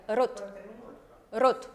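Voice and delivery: rot with a short, light R; Russian rot. Shown as a demonstration. Teacher showing the Russian rot to students. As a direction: say rot with a quick, barely tapped R. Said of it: "sino es cortica".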